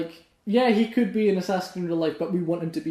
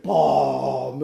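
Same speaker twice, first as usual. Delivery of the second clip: Bane voice; 5 semitones lower than usual. Retold or imitated. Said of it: "He's like".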